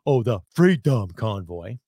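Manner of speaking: slow, sneering mimicry of critics; mocking quote. Host mimicking Twitter critics, slow mock sneer. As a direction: drag it out like a dismissive scoffer. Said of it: "They're saying".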